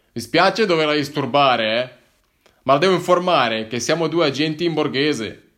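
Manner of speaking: formal, serious officer revealing himself; lightly voiced police officer. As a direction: plainclothes agent informing the hiker, officially. Plainclothes officer addressing narrator.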